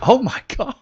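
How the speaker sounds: sudden high, loud gasp of shock. Retold or imitated. Hearing from an old victim on LinkedIn, shocked. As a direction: recoil in disbelief, high and loud. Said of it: "I was like".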